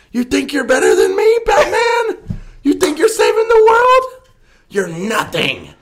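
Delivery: high shrieking villain taunt; Joker voice. Acted. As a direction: screech it like a manic villain.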